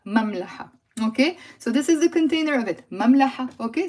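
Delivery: usual speaking voice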